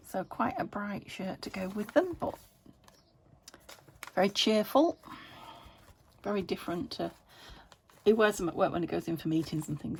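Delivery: ordinary talk, nothing marked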